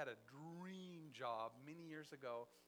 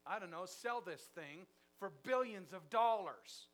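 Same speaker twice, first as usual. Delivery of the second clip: exasperated; 4 semitones higher, 10 dB louder, slower than usual. Acted. Frustrated businessman in parable.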